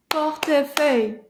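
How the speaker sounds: "Portefeuille" in three firm, separate beats; syllable by syllable. Shown as a demonstration. Teacher clapping syllables with class, firm separated beats. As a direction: clap out "Portefeuille" one syllable at a time. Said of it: "see if we can clap that one".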